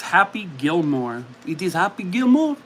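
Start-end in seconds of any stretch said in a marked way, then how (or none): 1.5-2.6 higher, hammed-up French accent. Confirming the movie answer for viewers, mock French accent. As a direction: confirm it as a cartoon French host. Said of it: "I should not have done the accent".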